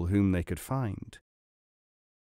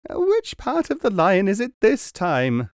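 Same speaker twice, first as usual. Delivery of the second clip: pained cry; 9 semitones higher, 4 dB louder than usual. A narrator's reading. Squirming client asks again.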